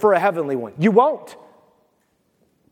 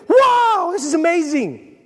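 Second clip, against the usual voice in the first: high, drawn-out awestruck "whoa"; acted wide-open wonder, pitched up; amazed; 7 semitones higher, slower than usual. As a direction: marvel at a dazzling sight. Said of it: "you're gonna be".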